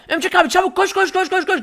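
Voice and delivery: put-on very high, frantic kid voice; little boy panicking at the alien; panicked little kid. Kid sidekick voice.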